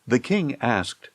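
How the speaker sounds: ordinary delivery, nothing marked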